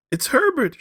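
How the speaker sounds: very high, loud scream; mother hearing her son knock. A narrator's reading. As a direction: shriek the name. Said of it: "she screamed".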